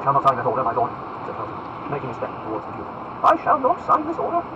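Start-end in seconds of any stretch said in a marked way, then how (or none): 0.0-0.9 firm forbidding. Acted. Felton forbids the Duke. 3.2-4.4 high, loud incredulous question; ringing lordly outrage; indignant exclamation. Acted. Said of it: "exclaimed Buckingham".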